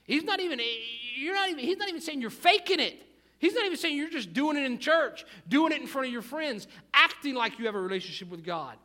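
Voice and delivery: loud, high-pitched sermon urgency; forceful preaching. Real, not acted. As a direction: drive it home to the congregation at full voice.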